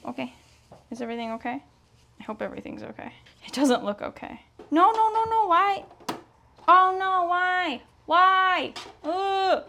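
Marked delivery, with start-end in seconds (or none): 4.7-9.6 high, drawn-out wail of dismay. Real, not acted. Sewer pleading with a jammed machine, high dismayed wail. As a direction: whine in real frustration, pitched high. Sewing machine jams, she wails.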